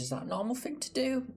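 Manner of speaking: very high, fast squeaky voice; cartoonish high-pitched self-questioning. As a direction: answer yourself in a silly squeak. Squeaky mock question to himself.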